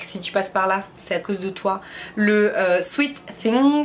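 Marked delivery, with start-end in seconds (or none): 2.8-3.8 exaggerated French-speaker English accent, higher pitched; higher-pitched attempt at an English accent. Real, not acted. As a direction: say it in showy, imperfect English accent. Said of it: "super mon accent anglais".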